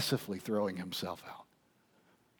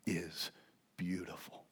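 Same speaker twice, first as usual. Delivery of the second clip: tender praise; 3 semitones lower, 11 dB quieter than usual. Retold or imitated. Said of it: "does and says".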